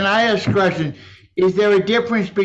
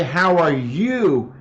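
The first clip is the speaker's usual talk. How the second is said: "you" stressed, slow falling; weight on "you"; slow "How are YOU?"; 2 semitones lower, much slower than usual. Shown as a demonstration. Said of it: "when I say".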